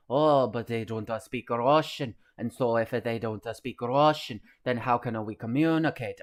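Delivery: loud, hammy Russian soldier voice with Italian lilt; bad Russian-Italian impression, complaining; mock Russian-Italian accent. Streamer mocks Russian soldiers complaining, loud mock Russian-Italian accent. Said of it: "somewhere between, uh, Russian and Italian".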